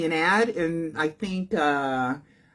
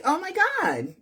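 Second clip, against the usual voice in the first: mock surprise; 12 semitones higher than usual. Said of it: "And I'm like".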